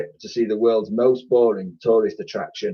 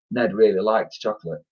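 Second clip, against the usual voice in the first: ordinary delivery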